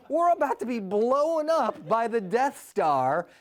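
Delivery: normal talking voice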